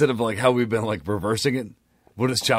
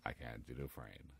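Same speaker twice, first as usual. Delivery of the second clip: low hushed Shawshank quote; Morgan Freeman impression; 11 semitones lower than usual. Cohost quoting Shawshank as Morgan Freeman, low and hushed. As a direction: mumble it low like the narrator. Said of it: "Morgan Freeman".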